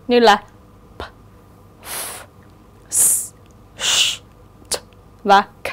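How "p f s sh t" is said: p, f, s, sh, t as bare unvoiced sounds; voiceless consonants. Shown as a demonstration. Teacher lists voiceless consonants, each sounded alone without voice. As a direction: make p, f, s, sh, t with breath only, one by one. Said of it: "vô thanh".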